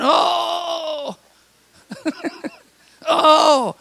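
high, drawn-out complaining groan; laughing mock moan. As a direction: groan as if everything aches. Moaning answer to how are you.

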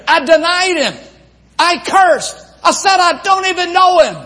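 high, loud despairing cry of Peter; anguished disciple confessing; Peter's anguish. Retold or imitated.

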